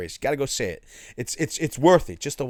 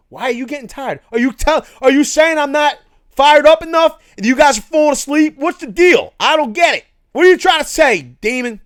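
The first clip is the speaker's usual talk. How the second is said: higher and louder, hammed-up hurt protest; mock outrage, pitched up and loud; mock indignation; 10 semitones higher, 9 dB louder than usual. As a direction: play at being offended by the chat. Mock offended at a chat comment.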